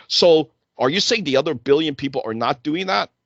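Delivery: ordinary talk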